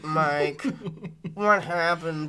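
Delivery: low, halting synthesized-voice imitation; Stephen Hawking impression. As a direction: imitate a speech computer, word by word. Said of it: "do a Steve Hawking impression".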